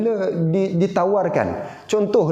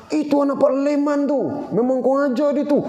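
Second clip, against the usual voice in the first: raised voice mocking a furious driver; road rage rant; 7 semitones higher than usual. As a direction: imitate a hot-tempered driver cursing. Angry driver insults a rider.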